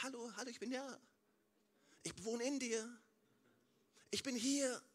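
ein Hauch von sanftem, leiserem Gott; Gott antwortet sanft. Acted. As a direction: antworte ruhig und beruhigend, fast amüsiert. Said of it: "Wenn du zu laut schreist, sagt er". (leicht gespielter Gott, der ruhig antwortet)

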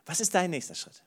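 usual speaking voice

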